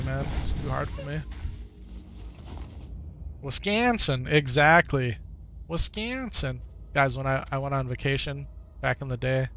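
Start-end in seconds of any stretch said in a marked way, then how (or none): 3.4-4.3 exaggerated Wisconsin accent, pitched up; high, swooping Wisconsin-accent "Wisconsin". Shown as a demonstration. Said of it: "I could tell from the accent". 5.7-6.6 softer, high-pitched Wisconsin twang; "Wisconsin" in a swooping Midwest accent. Shown as a demonstration. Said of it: "I could tell from the accent".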